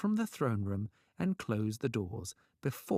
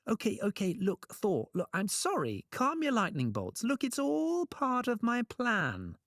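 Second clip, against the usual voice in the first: high, hurried-sounding backpedalling; panicky placating; 11 semitones higher than usual. A narrator's reading. Trickster shrinks from angry thunder god, panicky placating. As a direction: placate him, pitch staying high. Loki backs down before Thor.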